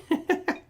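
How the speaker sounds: short, high-pitched chuckle; a quick burst of real laughter. Real, not acted. Host joking with his buddy, short chuckle.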